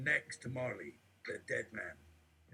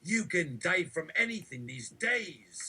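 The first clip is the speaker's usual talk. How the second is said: raised, loud sermon warning; loud preaching; 6 semitones higher, 7 dB louder than usual. Acted. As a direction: preach it out loud, slowing at the end.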